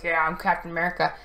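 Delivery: fast, brash, full of himself; cocky. Retold or imitated. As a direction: toss it off like a show-off. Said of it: "if Sam had been cocky and been like".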